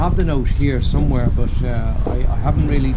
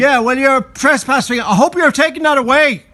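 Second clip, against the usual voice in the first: loud sharp voice; 12 semitones higher, 3 dB louder than usual. Real, not acted. Confronting trespasser over scaffolding.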